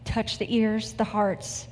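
earnest, measured prayer voice. Acted. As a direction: congregation prayer before the sermon, reverent and unhurried. Opens sermon with prayer.

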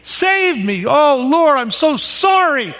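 imitating a student crying out loud and high for help; a retold, slow, pleading call to God; loud desperate cry. Student in the union crying out to God, loud and pleading. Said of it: "a loud voice".

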